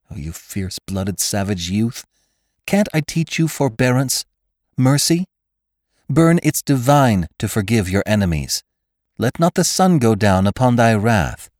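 pleading woman. A narrator's reading. Jane pleads for mercy.

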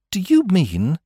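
high-pitched, direct query; blunt question. A narrator's reading. Mike questions Smith about paying his fees, bluntly. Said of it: "he asked bluntly".

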